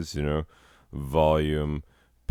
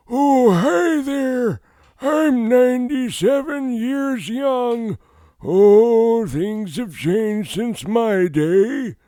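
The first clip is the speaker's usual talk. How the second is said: put-on much higher, louder, slow old person voice; breathy old voice; 8 dB louder, slower than usual. Shown as a demonstration. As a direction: play a breathy ninety-seven-year-old. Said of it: "put a lot of breath in your voice".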